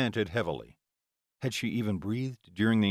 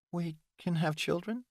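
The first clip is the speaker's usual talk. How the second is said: tearful question; 8 semitones higher, 4 dB quieter than usual. Acted. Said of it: "Delilah's eyes filled with tears".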